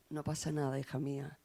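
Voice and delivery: put-on very soft, low-key reply to a child; hushed reassurance. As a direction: hush it down to reassure your daughter. Madre tranquiliza a su hija. (en voz muy baja, tranquilizando a la hija)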